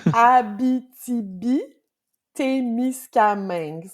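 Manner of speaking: Abitibi-Témiscamingue slowly, syllable by syllable; slow spelled-out French. Shown as a demonstration. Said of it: "let me say that slowly".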